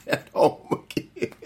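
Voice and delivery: words dissolving into chuckles; amused laughing. Real, not acted. Preacher joking about getting caught at home, laughing. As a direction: finish the line through a laugh. Laughs at own punishment story.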